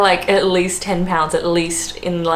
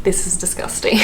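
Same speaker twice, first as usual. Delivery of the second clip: whispered disgust. Retold or imitated. Reacting to steamed vegan buffet.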